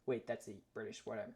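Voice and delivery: ordinary talk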